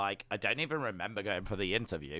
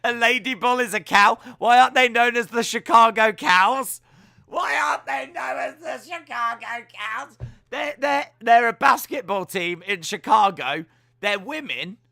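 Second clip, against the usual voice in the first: excited rant; 11 semitones higher, 12 dB louder than usual. Real, not acted. Ranting about the team name.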